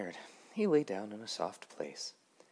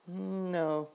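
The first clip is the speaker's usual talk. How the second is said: lively Grasshopper; 12 semitones higher than usual. Acted. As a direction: ask it brightly, a bit higher. Grasshopper voiced in story.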